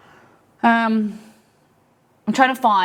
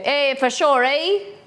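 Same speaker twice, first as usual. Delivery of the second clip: mock Canadian accent; 7 semitones higher, slower than usual. Shown as a demonstration. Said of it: "You go".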